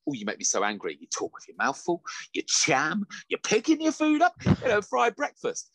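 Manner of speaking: rising exasperated mock rant; mock angry rant. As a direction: rant at the screen, building up. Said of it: "I'm like".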